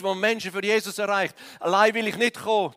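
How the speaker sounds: ordinary talk, nothing marked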